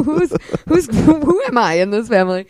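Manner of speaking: high, loud, stammered disbelief; incredulous. Retold or imitated. Playwright asking where she'd fit in her play, incredulous. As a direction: stumble into the question, half laughing. Retells incredulous questions to the actress.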